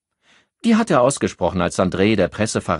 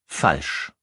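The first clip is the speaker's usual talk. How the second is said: sharp; 3 semitones lower than usual. A narrator's reading. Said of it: "antwortete Henri scharf".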